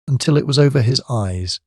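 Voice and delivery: ordinary delivery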